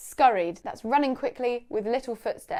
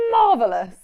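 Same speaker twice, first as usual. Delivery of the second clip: posh delivery; 9 dB louder than usual. Shown as a demonstration. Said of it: "sound really quite posh".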